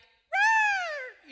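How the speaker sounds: put-on shrill little-boy roar, very high and loud; piercing high roar, held long; high-pitched kid's roar. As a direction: squeal out a roar like a small boy. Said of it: "high-pitched voice".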